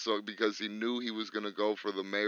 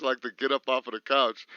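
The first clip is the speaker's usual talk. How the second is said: normal talking voice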